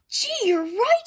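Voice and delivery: put-on high, loud eager girl; girl's voice. A narrator's reading. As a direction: exclaim like a delighted little girl. Girl agrees with wolf.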